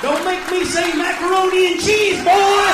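loud, high shouted threat; yelling threat. Real, not acted. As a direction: bellow it like a warning to the crowd.

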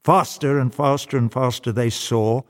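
plain reading voice

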